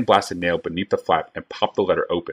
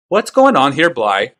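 higher, louder commanding scholar; stern scholar; 7 semitones higher, 5 dB louder, slower than usual. A narrator's reading. Tokasia cutting into Bly's quarrel, higher and louder. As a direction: cut into the quarrel with authority.

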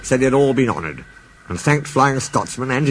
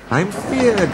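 a lightly raised Scottish worry; Scottish engine; 9 semitones higher than usual. Acted. Donald frets to the engines, in a mild Scottish voice. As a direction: Donald frets in a Scots lilt.

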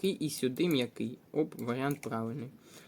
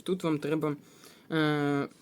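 ordinary talk, nothing marked

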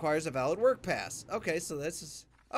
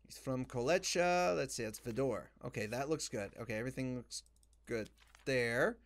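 usual speaking voice

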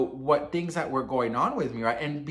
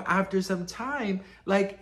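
usual speaking voice

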